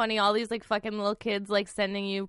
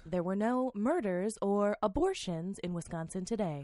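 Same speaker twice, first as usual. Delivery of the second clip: slow, soft news read; a lightly done public radio host; 9 dB quieter than usual. Shown as a demonstration. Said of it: "Give it, give it to us".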